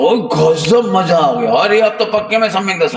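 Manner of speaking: rising, laughing mock rapture that climbs to a high shout; a teacher mocking a seeker who thinks he is enlightened, in hammed-up rapture; mock ecstatic seeker. Mocking an overjoyed deluded seeker.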